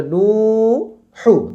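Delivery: নু held long, হু short; stretched first syllable. Shown as a demonstration. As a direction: sustain নু, then a quick হু. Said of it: "নুতে টান".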